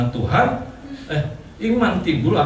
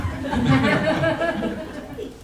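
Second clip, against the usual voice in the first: airy laugh, much higher; 13 semitones higher than usual. Real, not acted. Pastor unsure how to pray for the sick, high laugh.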